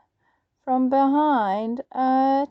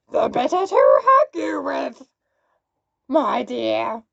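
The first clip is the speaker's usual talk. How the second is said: growled; 5 dB louder than usual. Acted. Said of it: "growled the wolf".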